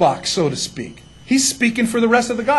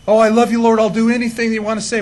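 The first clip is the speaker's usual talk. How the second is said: loud gushing pledge, hammed up; mock devotion; 2 semitones higher, 5 dB louder than usual. Believer promising the Lord anything, mock-eager. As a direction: imitate an over-eager believer, starting big.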